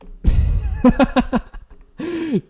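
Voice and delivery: loud high laugh; laughing burst. Real, not acted. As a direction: cackle at the dig. Laughs at own tease.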